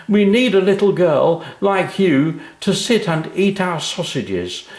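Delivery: high, hearty jovial voice of a friendly man; a jolly businessman amused; cheery big man. A narrator's reading. Sausage maker pitches an advert.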